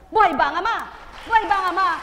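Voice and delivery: high and loud; loud preaching call. Acted. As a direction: rouse the congregation. Preacher calling to crowd.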